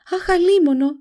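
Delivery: high, loud cry of alarm; sudden cry. A narrator's reading. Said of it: "φώναξε ξαφνικά". (ξαφνική κραυγή)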